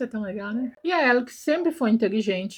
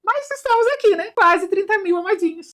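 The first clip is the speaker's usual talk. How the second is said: proud excitement; 9 semitones higher, 4 dB louder than usual. Real, not acted. Proud of her channel's growth.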